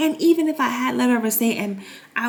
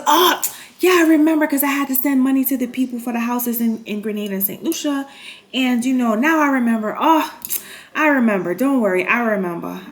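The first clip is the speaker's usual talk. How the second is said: mock stepdad; 4 semitones higher, 4 dB louder than usual. Stepdad voiced, making excuses.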